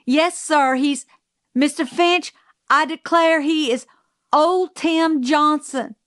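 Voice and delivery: high-pitched, pressing report; urgent alarm. A narrator's reading. Calpurnia warning Atticus about a mad dog, alarmed.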